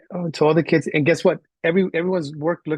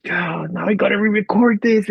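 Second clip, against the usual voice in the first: exasperated inner groan; 7 semitones higher, 3 dB louder than usual. Retold or imitated. Teacher's exasperated thought re-enacted.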